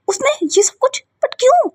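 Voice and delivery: a tearful, high-pitched why; tearful disbelief. Acted. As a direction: cry out why, near tears. Reacts to Rocky's deed.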